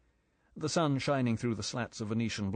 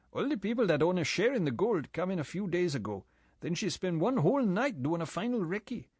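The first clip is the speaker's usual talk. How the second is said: higher-pitched island boatman, easy and gossipy; 6 semitones higher than usual. A narrator's reading. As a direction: play the chatty local boatman, voice pitched up. Boatman character voiced.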